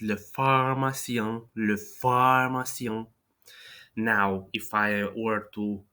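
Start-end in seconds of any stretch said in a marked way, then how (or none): 0.0-3.1 pharmacien slowly, nasal final vowel; nasal French ending. Shown as a demonstration. Teacher demonstrates tongue back for nasal. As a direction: draw out le pharmacien, nasalising the end. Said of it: "kind of like this".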